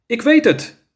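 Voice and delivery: put-on high child's call-out. A narrator's reading. Lizzie has names for the tigers, calls out high. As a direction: pipe up with a sudden idea. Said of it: "riep Lizzie".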